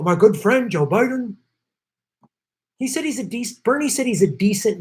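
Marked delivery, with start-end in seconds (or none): 0.0-1.4 Trump impression. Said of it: "Trump's not gonna go".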